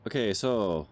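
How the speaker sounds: ordinary talk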